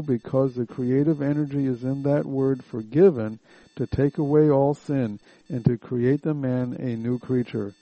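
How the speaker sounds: ordinary delivery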